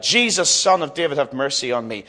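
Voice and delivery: normal talking voice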